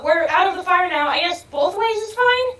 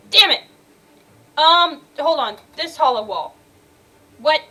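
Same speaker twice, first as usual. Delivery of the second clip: ordinary talk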